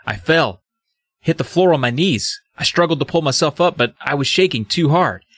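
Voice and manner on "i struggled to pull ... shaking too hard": ordinary talk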